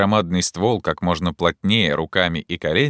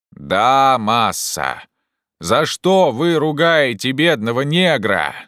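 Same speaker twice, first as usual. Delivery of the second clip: slow, high, stretched-out protest; calling down; 6 semitones higher, 4 dB louder, slower than usual. Acted. Jupiter protests the scolding, calling down. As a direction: holler back a protest. Scolded servant answers from above.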